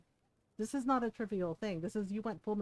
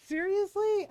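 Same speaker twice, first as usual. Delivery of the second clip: higher, louder disbelieving seriously; can you believe it; incredulous; 10 semitones higher, 6 dB louder than usual. Real, not acted. Reacting to the friend's attitude, high and incredulous.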